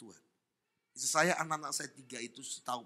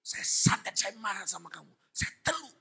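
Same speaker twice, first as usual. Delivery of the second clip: loud outburst climbing high; acted anger; 7 semitones higher, 5 dB louder than usual. Sorcerer telling the preacher he cursed him, acted anger. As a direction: imitate an angry sorcerer confessing. Acts out sorcerer's angry confession.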